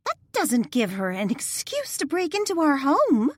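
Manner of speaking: high, sharp indignant snap; offended wife snaps at Danielle; snapping. Acted. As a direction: bite off the words at the intruder. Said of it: "Darlene snapped".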